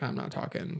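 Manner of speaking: whispered mock reply; unvoiced little whisper. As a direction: hush it like someone refusing to speak. Voicing a silent tongue-tied person.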